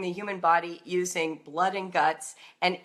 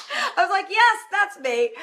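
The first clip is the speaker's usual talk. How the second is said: laughing recognition; 12 semitones higher, 6 dB louder than usual. Retold or imitated. Homeschool mom recognising herself in HENSE, laughing exclamation. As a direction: burst out in delighted self-recognition.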